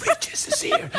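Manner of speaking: very high, mock-creepy announcement; spooky falsetto, played for laughs; horror-trailer voice. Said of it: "a horror movie called The Regis".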